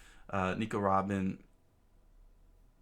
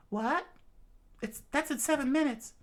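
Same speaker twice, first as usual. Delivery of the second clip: high, surprised gasp of alarm; high and startled; shocked high voice. Acted.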